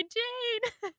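high gushing Jane; excited squeal. Retold or imitated. Host reading her notes, a lightly gushing cry of the name. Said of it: "my notes just say".

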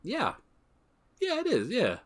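a high, pleased yes; high reassured voice. Retold or imitated. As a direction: answer yourself up high and satisfied. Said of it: "same video and says".

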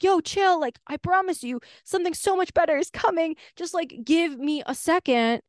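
the universe as a breezy, easygoing voice; chill universe. Retold or imitated. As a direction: soothe an impatient friend from above. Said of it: "the universe is like that owner".

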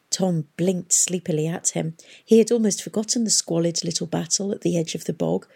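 plain reading voice

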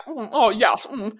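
mock posh British, with smug mms; hammed-up British toff; bad British accent. Mimicking people who fake British, in a plummy British accent. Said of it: "the voice that people put on when they think they're doing a British accent".

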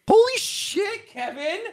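very high, drawn-out disbelief; amazed. Real, not acted. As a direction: squeak out stunned admiration for a friend. Amazed at news of turning someone away.

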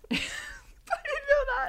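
very high, slow, squealing; shocked squeal. Real, not acted. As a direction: squeal in disbelief. Shocked laughing.

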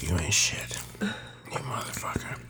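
whispering. Real, not acted. Friend cursing after spicy dab.

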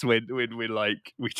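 ordinary talk

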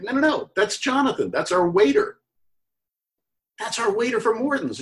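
a giddy reveal to his wife; excited reveal. Retold or imitated. Dan telling his wife the actor is their waiter, excited.

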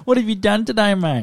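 high-pitched broad Australian matey question; rougher Australian accent. Retold or imitated. Mocking interstate speakers for co-host, broad Australian accent. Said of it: "Versus like".

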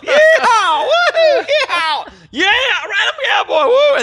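loud high yeehaw whoops; excited whooping. Pretend. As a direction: whoop like a cowboy roping horses. Roping the fire mares.

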